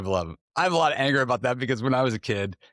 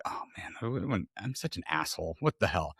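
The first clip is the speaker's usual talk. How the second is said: low, soft self-reproach; feeling bad; 4 semitones lower, 8 dB quieter than usual. Man feeling bad about what he did, quietly self-reproachful. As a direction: mutter at yourself, retold regret. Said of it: "I would feel bad about it".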